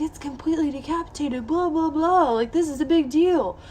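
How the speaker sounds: high-pitched mock alarm; alarmed doctor impression. Patient mimics doctor overreacting in the office, high mock alarm.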